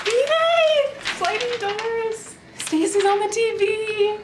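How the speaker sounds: high, drawn-out delighted squeal; excited cheering. Real, not acted. Friend celebrating sliding doors and TV, excited cheering. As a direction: stretch each phrase slowly up high in glee.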